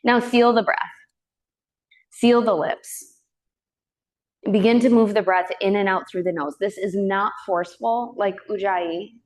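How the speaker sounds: calm, unhurried yoga teacher voice with long pauses; even, measured breath-awareness cueing; guided meditation. Real, not acted. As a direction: gently guide them into the breath.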